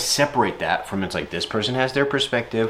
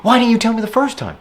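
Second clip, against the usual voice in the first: put-on much higher and louder, quick startled girlfriend; girlfriend's reaction; 10 semitones higher, 4 dB louder, faster than usual. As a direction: squeal it out in her voice fast. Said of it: "And she's immediately like".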